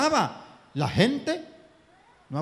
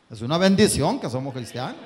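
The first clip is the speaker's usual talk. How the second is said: louder, rising on bendición; emphatic; 2 semitones lower, 5 dB louder than usual. Acted. Preacher insisting to the congregation, emphatic. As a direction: proclaim it firmly. Same sentence said twice.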